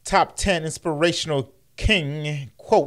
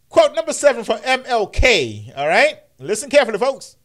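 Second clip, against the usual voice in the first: louder, higher hype-up; pumped mock MC; hyped announcer; 7 semitones higher, 5 dB louder than usual. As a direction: build the crowd before the quote. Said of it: "hyping the next quote".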